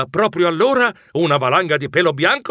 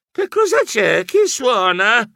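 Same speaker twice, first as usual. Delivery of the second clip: much higher, drawn-out voice; startled sleepy call; 7 semitones higher, slower than usual. Acted. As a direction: call out groggily at the door. Sleepy Geronimo opens door.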